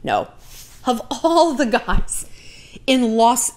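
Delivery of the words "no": a hint of flat repeated no; low, fading string of noes, mildly; firm refusal. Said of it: "the actual Shallon kicked in".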